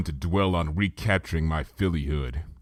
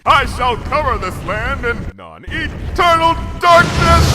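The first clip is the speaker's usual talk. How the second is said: loud, high, slow royal decree; grand proclamation; 8 dB louder, slower than usual. A narrator's reading. Luna vowing darkness over the land, booming. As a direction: proclaim it from the throne.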